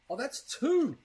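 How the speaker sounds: surprised. Real, not acted. Discovers second gift inside.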